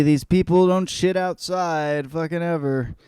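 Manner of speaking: ordinary talk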